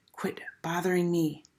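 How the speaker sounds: soft unvoiced whisper; boy whispering to the girls pestering him. A narrator's reading. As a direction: barely breathe the words. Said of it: "Jeff said very quiet".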